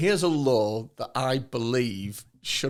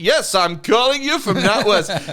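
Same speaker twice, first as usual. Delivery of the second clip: scam caller voice; 5 semitones higher, 5 dB louder than usual. Retold or imitated. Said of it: "I'm not doing a specific accent here".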